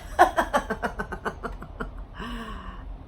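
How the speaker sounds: long soft laugh at the absurdity; real soft laughter. Real, not acted. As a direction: let out a quiet, helpless laugh. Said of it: "I started laughing".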